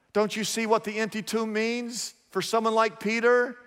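ordinary talk, nothing marked

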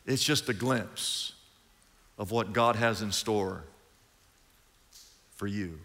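dropping low and soft in awe; hushed awe. Real, not acted. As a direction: let it fall to a reverent hush. Awed hush after a sigh.

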